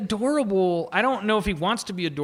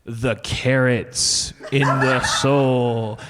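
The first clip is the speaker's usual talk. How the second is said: mock spooky narrator; 6 semitones lower, much slower than usual. Mimicking the host's narration.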